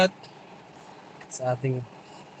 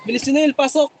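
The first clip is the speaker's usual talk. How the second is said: calling out; 8 semitones higher, 4 dB louder than usual. Real, not acted. Calling to Junel in rain.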